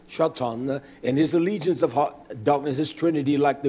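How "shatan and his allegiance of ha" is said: ordinary talk, nothing marked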